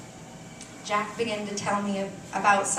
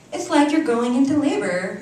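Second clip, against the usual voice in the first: louder, higher cheerful teasing; 5 semitones higher, 8 dB louder than usual. Retold or imitated. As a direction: tease her brightly like a happy roommate. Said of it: "my roommate said cheerfully".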